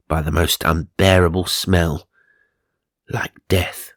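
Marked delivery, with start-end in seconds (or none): 3.1-3.9 a spooky storyteller's whisper on the last words; eerie whisper. A narrator's reading. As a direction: breathe it out in a hushed whisper. Spooky line in ghost story.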